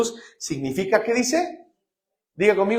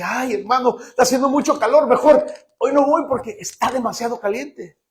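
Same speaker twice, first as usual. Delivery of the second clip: higher, mildly whiny complaint; heat excuse; 9 semitones higher, 6 dB louder than usual. Retold or imitated. Churchgoer excusing himself from church for the heat, whiny. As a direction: grumble about the heat. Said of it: "o sea que".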